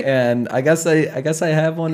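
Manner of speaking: laughing admission; laugh, then concede it through the laugh. Real, not acted. Host admitting his accent to the guest, laughing.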